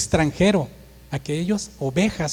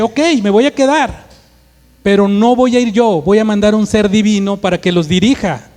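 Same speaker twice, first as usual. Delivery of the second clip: loud, raised, higher-pitched declaration; forceful concession; 3 semitones higher, 6 dB louder than usual. Acted. God answers Moses about leading the people, loud and emphatic.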